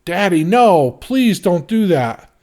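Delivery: loud, high pleading scream; a girl screaming no at her father; screaming daughter. A narrator's reading. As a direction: scream it at him, begging. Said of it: "His daughter screamed".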